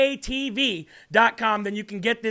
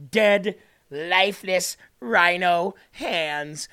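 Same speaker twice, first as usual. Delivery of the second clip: slow taunt; 3 semitones lower, much slower than usual. Acted. Taunting Ryan.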